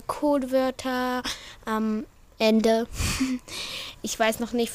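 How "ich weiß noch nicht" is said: ordinary talk, nothing marked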